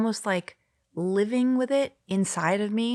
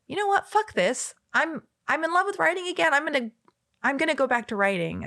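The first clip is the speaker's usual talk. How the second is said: much higher, defiant burst of joy; fired-up resolve; 9 semitones higher than usual. Retold or imitated. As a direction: declare it like a sudden epiphany. Retold decision to write again.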